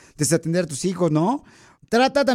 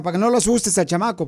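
usual speaking voice

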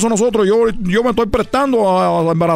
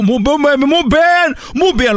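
elogio exagerado; 6 semitones higher, much slower than usual. Acted. Drawn-out, excited praise.

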